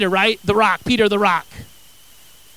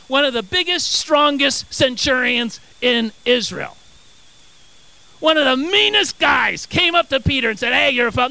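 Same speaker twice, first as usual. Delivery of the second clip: loud, raised, mock-epic reading; hammed-up bombast; 4 semitones higher, 4 dB louder than usual. Preacher inventing a tougher accuser for Peter, bombastic. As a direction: belt it out, punching the superlatives.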